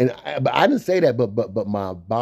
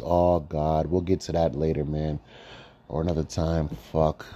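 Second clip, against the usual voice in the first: ordinary talk, nothing marked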